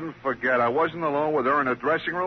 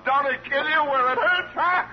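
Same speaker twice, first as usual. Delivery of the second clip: laughing; 10 semitones higher than usual. Acted. Comedian laughing at own joke.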